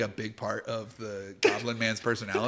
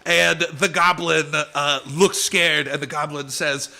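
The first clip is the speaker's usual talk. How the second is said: ordinary delivery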